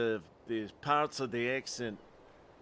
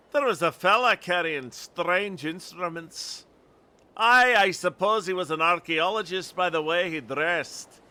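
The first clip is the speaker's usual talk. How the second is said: higher, louder Scottish storyteller; Scottish accent; 4 semitones higher, 5 dB louder than usual. Acted. Lookout describing a stranger to searchers, Scottish accent. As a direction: recount it in emphatic put-on Scottish. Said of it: "if my Scottish accent is bad".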